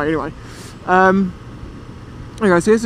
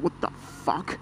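hushed, breathy shock at the blood; shocked whisper; 2 semitones lower, 12 dB quieter, slower than usual. Retold or imitated. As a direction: whisper it as if you just saw blood. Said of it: "and I'm like".

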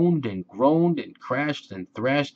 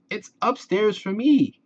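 high happy yell; 11 semitones higher, 4 dB louder than usual. A narrator's reading. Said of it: "yelled Ted".

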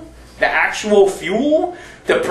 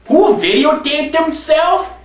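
ordinary delivery

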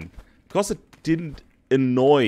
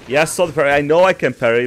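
loud, higher, heated retort; irritated shout; 4 semitones higher, 8 dB louder, much faster than usual. Real, not acted. Player defends himself about parrying, angry and loud. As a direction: insist over the top of them.

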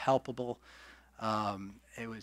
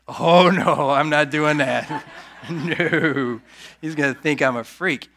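laughing protest; 4 semitones higher, 9 dB louder than usual. Retold or imitated. Refusing God's prompting, laughing.

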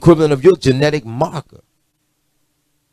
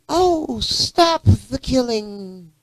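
mocking plea; 9 semitones higher, 6 dB quieter, much slower than usual. Mocking what people say.

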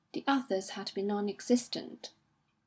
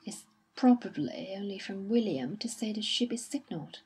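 usual speaking voice

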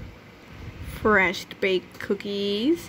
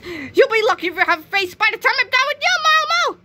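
loud, much higher yelling threat; furious threat; 11 semitones higher, 5 dB louder than usual. Acted. Dee's friend yells at the bully Milmo, furious. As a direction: scream it at the bully.

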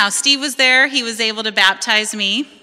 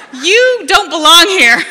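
higher, louder mock mom, laughing through it; imitating a blunt mom; 10 semitones higher, 10 dB louder than usual. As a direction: play the disbelieving mother, half-laughing. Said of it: "She goes".

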